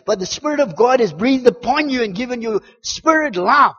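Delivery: loud, high, impassioned preacher; full-force preaching. Real, not acted. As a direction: drive it home to the congregation.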